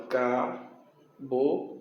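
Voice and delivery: very slow "Cabocla", ca... bo... cla; low, isolated syllables in "Cabocla"; split into syllables. Shown as a demonstration. Teacher answering how to separate it, "Cabocla" syllable by syllable. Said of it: "Como é que separa a sílaba?".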